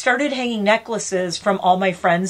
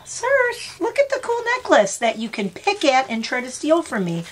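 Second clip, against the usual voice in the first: much higher cooing call; calling the bird; 7 semitones higher than usual. Real, not acted. Coaxing the pet bird to come and look, high cooing. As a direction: coo up high to tempt the bird.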